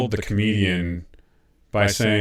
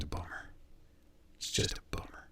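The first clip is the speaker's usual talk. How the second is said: whisper; 21 dB quieter than usual. Real, not acted. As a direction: whisper it as a sad aside. Whispered mock-sad aside.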